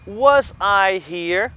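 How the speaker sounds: was I here drawn out slowly with a rise; slow question modelling. Shown as a demonstration. Teacher demonstrating the question slowly to students. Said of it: "eu vou dizer assim".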